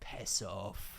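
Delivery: low, quiet Scottish dismissal; retold Scottish brush-off, dropped low; Scottish accent. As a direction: imitate a Scottish woman, soft and low. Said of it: "in a Scottish accent".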